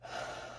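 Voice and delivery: soft unvoiced sigh letting go; release sigh. Real, not acted. Teacher sighs as the tapping releases tension. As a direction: let out a long releasing breath. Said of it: "you'll notice, and I just had one just then".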